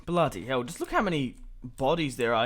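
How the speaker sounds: ordinary talk, nothing marked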